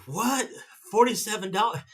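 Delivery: high, incredulous double take; retold shock. Retelling his buddy's phone reaction, shocked and incredulous. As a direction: echo the amount like he can't believe it.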